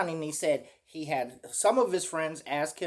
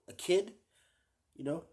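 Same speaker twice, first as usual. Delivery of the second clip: usual speaking voice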